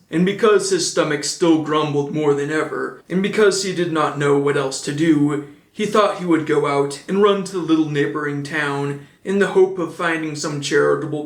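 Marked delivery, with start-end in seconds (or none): none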